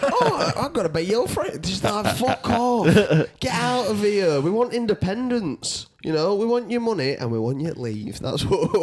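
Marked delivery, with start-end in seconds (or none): 0.0-1.6 high, sing-song, overly sweet greeting; mock polite English visitor. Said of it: "You're English, mate". 2.2-8.2 higher, slower, mock-hostile brush-off; mock Scottish local. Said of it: "Scottish people".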